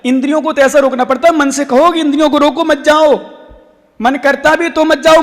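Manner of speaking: high, driving exhortation; forceful, rapid-building sermon; preaching. Real, not acted. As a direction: urge the listeners hard, phrase after phrase.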